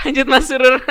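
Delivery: real laugh spilling into the words. Real, not acted. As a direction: say it while still laughing, high and bright. Laughing after joke about baper. (sambil tertawa lepas)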